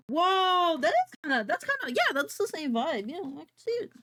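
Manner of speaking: high, loud excited gush. Real, not acted. Streamer reacts to the matching art, high and loud. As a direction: gush over the art, voice jumping up.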